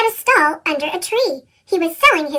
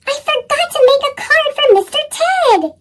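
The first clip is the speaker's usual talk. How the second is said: high, upset wail; dismayed cry; 9 semitones higher than usual. A narrator's reading. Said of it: "he cried".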